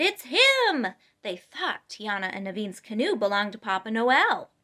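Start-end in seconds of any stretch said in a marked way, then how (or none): 0.0-0.9 high, loud cry of discovery; excited shout. A narrator's reading. River folk shouting that Papa Noel has come, excited shout. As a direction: call it out, thrilled to spot him. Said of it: "the river folk shouted".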